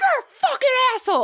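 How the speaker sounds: very high, loud, fast yell; furious shout. Retold or imitated. She yells back at the rude driver, high furious shout. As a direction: scream the insult right back. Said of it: "I'm like".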